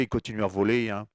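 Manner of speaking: usual speaking voice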